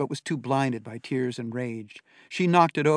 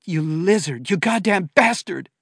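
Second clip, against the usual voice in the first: furious, louder and higher; crying with rage; 6 semitones higher, 9 dB louder than usual. A narrator's reading. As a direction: scream it through tears of fury. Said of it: "tears of rage".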